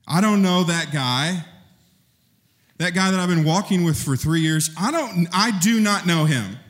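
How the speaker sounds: high, insistent I do not know him; panicked denial. Acted. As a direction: push the denial out fast and high. Peter denying Jesus, acted.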